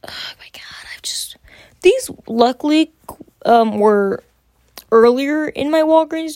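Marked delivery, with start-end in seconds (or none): 0.1-1.4 breathless whispered aside. Real, not acted. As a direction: whisper it in disbelief. Overwhelmed aside.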